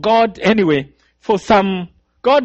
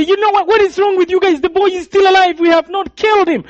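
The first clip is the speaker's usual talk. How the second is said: much higher, loud angry yelling; angry shout; 12 semitones higher, 3 dB louder, faster than usual. Retold or imitated. Killers shouting at each other that the boy lives, loud and high. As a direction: scold the comrades furiously.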